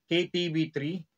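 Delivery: usual speaking voice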